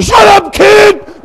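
high, loud outburst; angry snapping shout. Retold or imitated. Pastor yelling at kindergartner who said hi, high angry shout. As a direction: yell it to cut the greeting off. Snaps at child greeting him.

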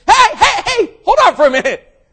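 very high, loud calls to stop; salesman calling out. Acted. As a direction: shout him down from outside.

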